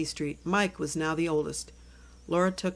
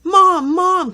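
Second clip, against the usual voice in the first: high, loud call for mom; 12 semitones higher, 15 dB louder than usual. Acted. Girl bursting in, calling for her mom, loud and high. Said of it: "Cookie said as she came into her house".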